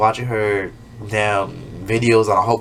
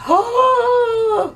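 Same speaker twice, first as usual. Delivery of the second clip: very high, loud squeal of joy; excited squeal; 4 dB louder than usual. Retold or imitated. Small YouTuber seeing views, high excited squeal. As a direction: shriek at the view count. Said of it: "I'm like".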